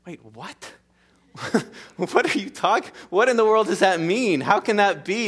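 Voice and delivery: incredulous, rising confusion; mock disbelief as Nicodemus; baffled Nicodemus. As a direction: act out a bewildered question. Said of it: "He's like".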